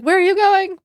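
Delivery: high, loud startled yell; mom's yell. Retold or imitated. Mom yells at son sneaking out, loud and high. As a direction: mom yelling at son climbing out the window.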